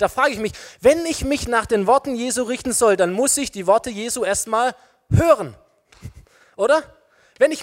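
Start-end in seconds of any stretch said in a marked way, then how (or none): none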